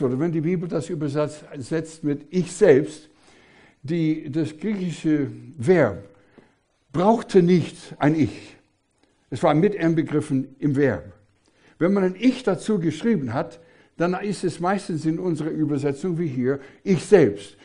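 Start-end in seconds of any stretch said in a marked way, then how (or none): 2.3-3.1 "ich selbst" punched louder; stress on ICH SELBST. Shown as a demonstration. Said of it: "ganz besondere Betonung". 16.8-17.6 "ich" hit hard, "selbst" louder too; stress on ICH SELBST. Shown as a demonstration. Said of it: "ganz besondere Betonung".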